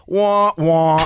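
loud, high mock wah-wah; sad trombone. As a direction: sing a deflating sad trombone. Mocking deflated answer.

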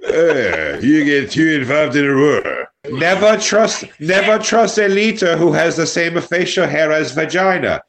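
mock German accent, deliberately pronounced; put-on German accent. Improviser warning about leaders in a German accent. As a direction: lecture them in a fake German accent. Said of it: "what kind of accents do they have".

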